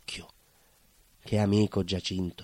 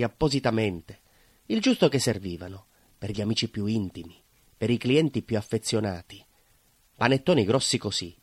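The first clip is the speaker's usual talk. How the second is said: usual speaking voice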